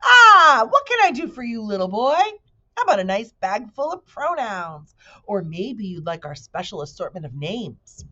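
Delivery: high, loud pitchman voice easing off; eager market salesman; booming salesman. Acted. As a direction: sell hard to the little boy. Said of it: "in a booming voice".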